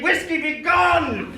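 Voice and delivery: slow, high, loud command; hammed-up order to be gone; dramatic banishing. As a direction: cast the bottle away like an exorcist. Scrooge banishes the spirit.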